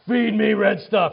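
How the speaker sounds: loud, slow, hungry plea; desperate demand. Retold or imitated. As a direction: demand food like a starving brute. Hungry hunter demanding food.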